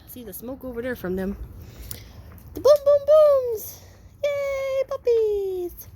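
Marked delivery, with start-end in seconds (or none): 2.5-5.9 high, loud, slow sing-song; excited puppy talk. Real, not acted. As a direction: turn the bangs into a party. Making fireworks fun for pups.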